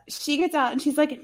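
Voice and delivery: ordinary delivery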